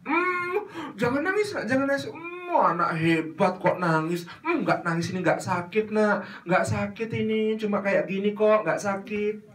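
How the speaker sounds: high sing-song cooing; mother cooing to stop a child's tears, high. Acted. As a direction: hush the child sweetly. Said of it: "kalimatnya, Bu".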